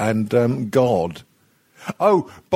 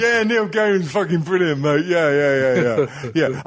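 lightly put-on matey voice, a touch brighter; a mild hint of a trendy know-it-all; trendy bloke reply; 5 semitones higher than usual. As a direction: play it cool and laddish to the co-host. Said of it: "I'll go with the previous one".

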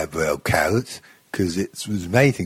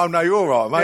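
pub bloke; 8 semitones higher, faster than usual. Retold or imitated. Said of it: "Yeah, yeah".

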